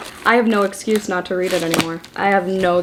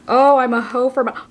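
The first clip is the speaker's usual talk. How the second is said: ordinary talk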